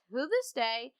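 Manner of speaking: ordinary talk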